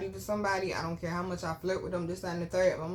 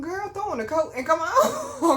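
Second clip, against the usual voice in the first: loud, high-pitched hurry-up; retold exasperated yell down the phone; exasperated urging; 10 semitones higher, 7 dB louder than usual. Daughter hurries mama out the door, exasperated.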